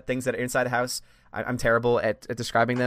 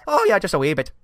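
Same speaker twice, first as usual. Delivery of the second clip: mock Irish accent, pitched higher; atrocious Irish accent; 5 semitones higher than usual. Host contrasting her usual phrase with a fake Irish accent. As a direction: put on a bad Irish accent. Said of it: "an atrocious Irish accent".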